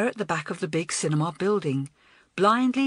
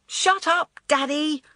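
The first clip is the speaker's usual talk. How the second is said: high, loud snap at her father; crying out; 9 semitones higher, 3 dB louder, slower than usual. A narrator's reading. Actress yells at her father in the car, loud and rude. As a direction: yell over the car horn. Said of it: "she cried out".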